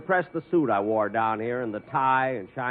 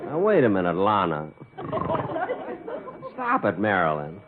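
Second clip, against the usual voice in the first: sleep talking; 3 semitones higher than usual. Acted. Boss dreaming of actresses.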